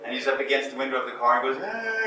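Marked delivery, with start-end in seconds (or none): 1.6-2.1 high, hammed-up whimper of a heartbroken guy; mock crying wail. Movie guy at the car window, mock crying wail. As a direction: imitate a sobbing cry, lightly. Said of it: "he's crying".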